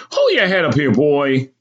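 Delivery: high, scolding command; mother's order. Acted. Mother jerks boy's head up.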